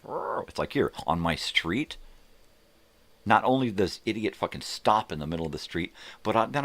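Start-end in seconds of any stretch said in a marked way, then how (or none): 0.0-0.5 short high growl of frustration; mock angry growl. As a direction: growl through the teeth like a fuming driver. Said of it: "I was just like".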